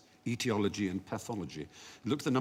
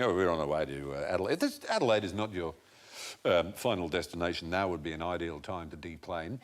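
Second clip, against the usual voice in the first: airline announcer; 7 semitones lower than usual. Retold or imitated. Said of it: "it's usually Australians. Australians get it right".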